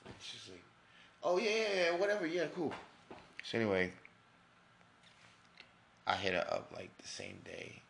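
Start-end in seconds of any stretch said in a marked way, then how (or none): 1.2-2.7 high, loud mock brush-off; mocking the girl who brushed him off; dismissive girl. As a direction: ham up her breezy "yeah whatever".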